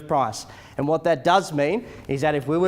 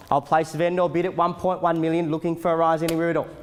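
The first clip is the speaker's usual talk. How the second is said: auctioneer calling bids; 3 semitones higher, 3 dB louder than usual. Acted. Live auction bidding calls.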